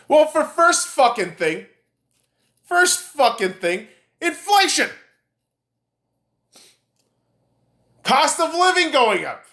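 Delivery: high, forceful listing of grievances; exasperated answer. Real, not acted. Ranter answers a doubter with reasons, exasperated and forceful. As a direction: punch out each item, pitch pushed up. Heated answer to imagined questioner.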